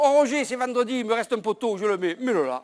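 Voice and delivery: loud, high, offhand worker voice; careless workman. Retold or imitated. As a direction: toss it off like a lazy worker on a Friday.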